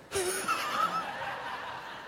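a quick high burst of laughter. Real, not acted. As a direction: laugh at the joke. Laughs at own joke.

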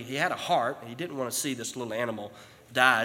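ordinary talk, nothing marked

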